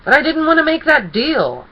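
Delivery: loud, high, outraged cry; indignant protest. A narrator's reading. Martingale protests the deal he was forced into, loudly outraged. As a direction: protest at the top of the voice.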